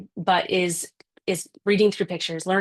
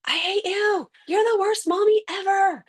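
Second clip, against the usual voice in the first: put-on high, whiny child yelling at mom; angry little kid; 13 semitones higher than usual. Child shouting at mommy, high and whiny. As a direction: play the furious small kid lashing out at mommy. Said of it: "where you start hearing the".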